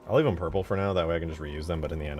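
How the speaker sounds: ordinary talk, nothing marked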